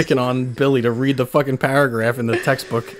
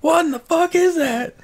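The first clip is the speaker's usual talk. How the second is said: drawn-out, high-pitched drill-sergeant outrage; Gunny; slower than usual. Acted. Quoting the drill sergeant confronting a recruit, outraged. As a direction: imitate a drill instructor, slow and incredulous. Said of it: "What about Gunny from, uh, Full Metal Jacket?".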